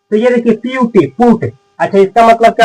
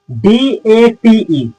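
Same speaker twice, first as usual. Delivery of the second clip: slow, separate letters; spelling out; much slower than usual. Shown as a demonstration.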